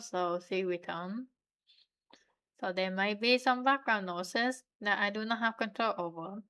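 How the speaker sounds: usual speaking voice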